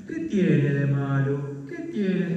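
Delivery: drawn-out, loud challenge; loud mocking "¿Qué tiene de malo?", each word stretched; loud, slow mock question. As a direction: throw the excuse back, slowly and loudly. Mocking society's excuse.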